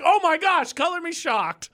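high, exasperated sarcasm; high sarcastic exclaiming; mock shock. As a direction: pitch it way up in fake amazement. Mocking others' sarcastic reaction.

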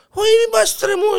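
high squeaky backpedalling; panicked protest. Acted. Hare hurriedly denying he insulted the lion, panicked.

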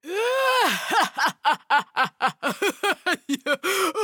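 long cackling ogre laugh; hammed-up creature guffaw, trailing off; mocking laughter. A narrator's reading. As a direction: burst out in a rolling, mocking laugh. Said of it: "the little man's mocking laughter".

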